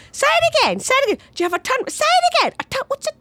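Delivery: very high, squeaky incomprehension; baffled shopkeeper. Retold or imitated. Shopkeepers not understanding mum's turnip, squeaky high. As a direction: squeal it like you can't understand her. Crowd mocking mum's accent.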